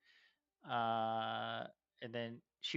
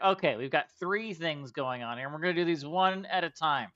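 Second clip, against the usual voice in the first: Juwila, brash warrior host; 3 semitones higher, 6 dB louder than usual. Acted. Juwila challenging the party to a strength contest: loud swagger. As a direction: taunt them into the contest.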